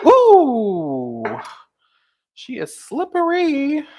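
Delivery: high, loud startled ooh; surprised. Real, not acted. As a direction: yelp at the slippery jar. Oil jar slipping in hand.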